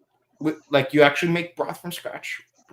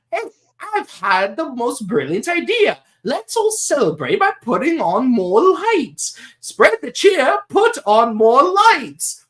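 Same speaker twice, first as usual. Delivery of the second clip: whiny scheming voice; 8 semitones higher than usual. Retold or imitated. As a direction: sell the trap with fake cheer. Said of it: "that whiny voice he has".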